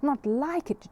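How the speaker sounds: bursting with disbelieving joy; delighted exclamation. Acted. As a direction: pitch jumps up on a thrilled NOT. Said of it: "cried the man".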